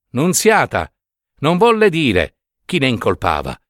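usual speaking voice

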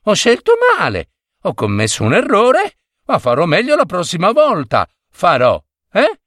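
quick, high, apologetic husband; placating husband. A narrator's reading. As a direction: hurry through excuses to calm her down. Narrator voices husband placating his wife. (marito che si scusa in fretta)

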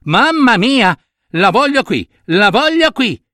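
tono alto e imperioso; pretesa capricciosa. Acted. Capriccio di chi pretende qualcuno. (capriccioso e insistente)

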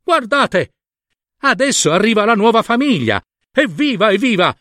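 high-pitched cheer from the farmyard crowd; storybook birds greeting newcomers, high and excited; excited exclaiming. Acted. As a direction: exclaim in delight at new arrivals. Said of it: "ed esclamarono".

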